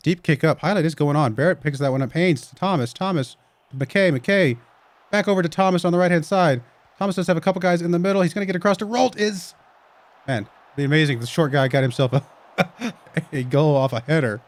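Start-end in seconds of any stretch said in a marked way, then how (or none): 0.1-9.6 lively match commentary; play-by-play. Acted. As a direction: call the game as it happens.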